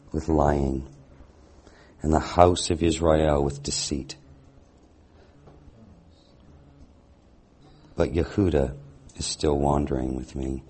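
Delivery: measured, deliberate Bible reading with long pauses; scripture reading. Acted. Teacher reading the prophet's words to the congregation, measured and solemn. Reads verse twelve.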